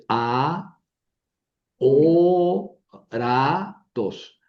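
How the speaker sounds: a, o, ra, tos spaced out, four separate syllables. Shown as a demonstration. Teacher splits aóratos for students, syllable by syllable. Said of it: "palabra de cuatro sílabas".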